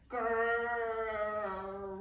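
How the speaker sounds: drawn-out rumbling grrr; a long hungry tummy growl; belly rumble growl. A narrator's reading. Storyteller voicing the bear cub's rumbling belly. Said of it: "rumbled from the bear's belly".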